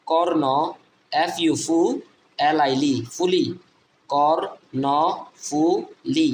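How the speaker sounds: Karnaphuli very slow, split kor-no-fu-li; syllable by syllable. Shown as a demonstration. Teacher breaking a river name down for learners, syllable by syllable. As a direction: pause after each syllable of Karnaphuli.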